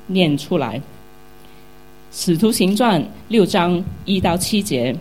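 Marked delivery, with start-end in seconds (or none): 2.1-5.0 louder, measured Bible reading voice; scripture reading. Acted. Pastor reading Acts chapter six aloud to the congregation, loud solemn reading. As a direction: proclaim the verses to the whole church.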